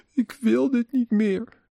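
put-on high, drawn-out emotional young woman's plea; geëmotioneerde jonge vrouw. A narrator's reading. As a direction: call out slowly in a high, upset young woman's voice. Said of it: "de jonge vrouw roept geëmotioneerd". (geëmotioneerd roepende jonge vrouw, hoge stem)

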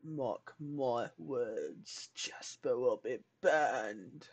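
low and slow; ominous. Shown as a demonstration. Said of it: "That was really good".

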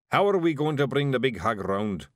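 lightly roughened-up Scots character voice; Scottish Feegle. A narrator's reading. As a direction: ask it as a puzzled Scot. Voices Big Yan, a Feegle.

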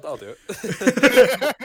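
laughing while saying it. Real, not acted. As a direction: laugh through the words. Laughing through agreement.

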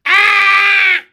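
loud high scream. Acted. Frog wife screams in defiance at husband, screaming. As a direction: let out a piercing, sustained yell. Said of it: "I'll scream".

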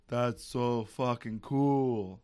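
slow Danish accent imitation; Nick Refn Danish accent. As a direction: drawl it out slowly in a Danish accent. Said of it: "in his Danish voice".

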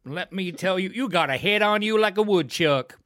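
big hammed-up Southern accent. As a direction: holler it like an irate Southerner. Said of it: "I don't think a redneck would".

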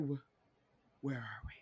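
bewildered, barely audible murmur; hushed, acted-out confusion; confused whisper. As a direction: whisper it, completely lost. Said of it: "the most confused faces".

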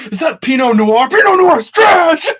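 high, slow screaming put-down; mock coach yell. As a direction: scream the verdict at a trainee. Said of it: "and he's like".

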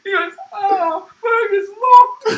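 high, loud, slow panicking cries; a frantic high yelp of despair; panicked cries. Retold or imitated. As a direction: imitate a desperate man finding the door locked. Coworker finds door locked.

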